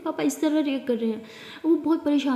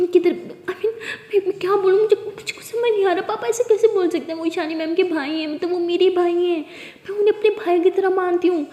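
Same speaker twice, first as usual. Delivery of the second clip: much higher, nervous laugh breaking in; flustered distress; 5 semitones higher, 3 dB louder than usual. Acted. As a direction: fumble for words in panic. Pari distraught, accused by sister.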